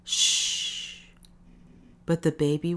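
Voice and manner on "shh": long unvoiced shush; hushing shh. A narrator's reading. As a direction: hush a crying baby. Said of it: "Someone went".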